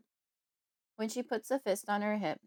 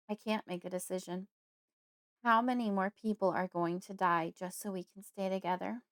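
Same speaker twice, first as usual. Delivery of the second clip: plain reading voice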